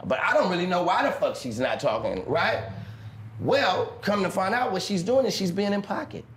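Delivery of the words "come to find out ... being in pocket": ordinary talk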